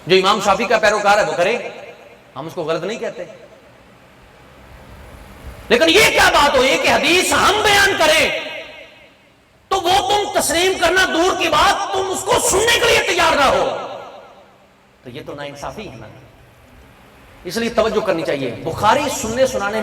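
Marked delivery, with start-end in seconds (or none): 5.7-13.8 high shouted indignant preaching; full-force preaching. Real, not acted. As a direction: protest at the top of your voice. (جوش سے چیختا ہوا خطاب)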